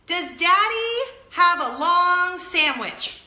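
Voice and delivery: put-on high, slow singsong little girl; singsong child voice. Mother imitating her daughter's high singsong question. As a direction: sing it out like a curious five-year-old. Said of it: "she goes".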